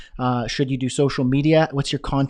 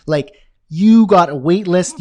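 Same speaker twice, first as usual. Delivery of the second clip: louder and slower, like a boast; excited, louder; 3 semitones higher, 7 dB louder, slower than usual. Shown as a demonstration. Host to viewers, triumphant slow boast. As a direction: savor having a wait list. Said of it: "you feel like this".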